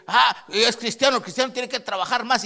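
high, loud jeering quote; high loud mockery; mocking unbeliever. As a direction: sneer like the scoffers. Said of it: "Le dicen".

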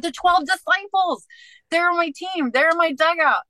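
bursting with the answer she just found; excited answer. Retold or imitated. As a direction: blurt it out like a sudden revelation. Retelling her excited answer to God.

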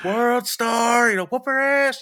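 high, loud hype yell; egging on a fight. Retold or imitated. Drunk guy hyping a street fight from the sidelines, loud and high. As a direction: whoop it up like a rowdy spectator.

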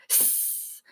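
long, soft sibilant hiss; drawn-out "sss"; menacing hiss. A narrator's reading. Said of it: "then hissed at her".

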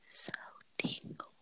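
soft ASMR whisper. Pretend. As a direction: whisper it close to the mic. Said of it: "We can do a A S M R episode".

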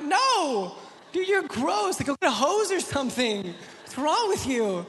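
high, loud, exasperated dad; acted-out appalled protest; disgusted refusal. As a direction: push away a gross kid in comic horror. Dad refuses messy crying son.

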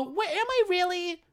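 very high, exaggerated puzzled teacher; teacher stopping class to ask how the name is said; mock teacher. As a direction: overplay a teacher's disbelief. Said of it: "And they go".

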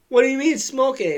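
put-on a kid baffled by the bong, asking fast; baffled question. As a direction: rush it out, totally lost. Confused at instruction.

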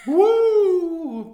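high, loud whoop; party cheer. Real, not acted. Celebrating episode ten.

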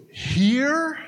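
high, squeaky "Here?"; shocked, voice jumping way up; shocked disbelief. Acted. Joe stunned that the baby comes in the truck, high and shocked.

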